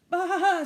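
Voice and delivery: loud high baa; sheep baa. Acted. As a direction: bleat like a sheep. Sheep sound in the story.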